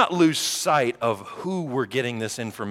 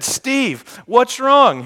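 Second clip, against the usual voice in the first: put-on girl asking; 13 semitones higher, 9 dB louder, much slower than usual. Girl from high school asks.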